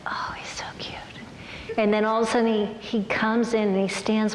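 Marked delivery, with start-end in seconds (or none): 0.1-1.0 breathy, unvoiced girlish crush whisper; hushed, secret swoon. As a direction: whisper it to yourself, smitten. Repeats her smitten private thought.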